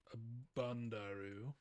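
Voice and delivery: hammed-up Japanese accent; mock Japanese accent. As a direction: put on a caricatured Japanese accent, softly. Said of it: "a mock Japanese accent".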